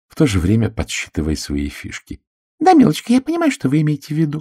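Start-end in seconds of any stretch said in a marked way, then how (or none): 2.6-4.4 very high, fast meek coo. A narrator's reading. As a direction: placate her gently. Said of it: "кротко сказала она".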